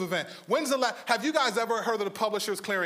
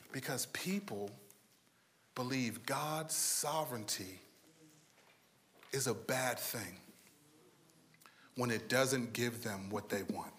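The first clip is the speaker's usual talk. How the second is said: soft, low, drawn-in sermon voice; hushed preaching; 9 semitones lower, 12 dB quieter than usual. Acted. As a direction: lean in and preach softly. Quiet intimate sermon passage.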